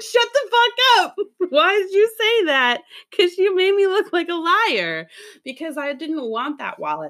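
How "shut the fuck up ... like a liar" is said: much higher, slower, laughing outrage; mock outraged. Stylist snaps at her coworker, high mock outrage. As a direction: scold the coworker in mock horror. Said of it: "I was like".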